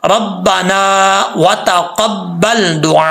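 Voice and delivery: रब्बाना drawn out with the long alif, व तकब्बल slow and careful; careful slow recitation; slow correct reading. Shown as a demonstration. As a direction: read रब्बाना व तकब्बल slowly and fully. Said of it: "ऐसे नहीं पढ़ना जल्दी में".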